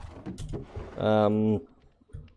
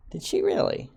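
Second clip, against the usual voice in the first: much higher squeaky repeat, stress on she; mock squeaky voice; 3 dB quieter than usual. Streamer echoing himself for chat, mock squeaky. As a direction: squeak it back as a joke.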